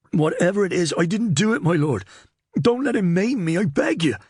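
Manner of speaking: high-pitched panicked begging; desperate entreaty. A narrator's reading. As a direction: beg the lord for mercy. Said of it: "cast Bradicate a look of entreaty".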